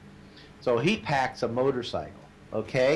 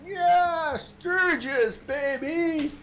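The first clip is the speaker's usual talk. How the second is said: excited whoop; much slower than usual. Retold or imitated. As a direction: whoop it to passing drivers. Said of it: "he's going".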